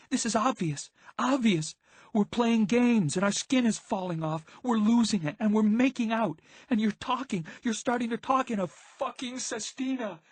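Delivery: high, frantic yelling rant. A narrator's reading. Violet, shaking, rants at the partying friends, yelling. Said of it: "She suddenly was yelling".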